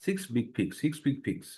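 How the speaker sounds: "সিক্স বিগ ফিক্স" run together smoothly, short ই in each word; tongue twister said as one run. Shown as a demonstration. Teacher drilling students in one flowing phrase. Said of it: "এটা একসাথে বলবেন".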